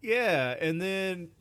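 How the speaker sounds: high, eager yeah; excited. Real, not acted. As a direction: jump back in with excitement.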